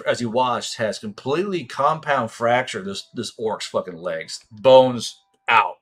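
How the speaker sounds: ordinary talk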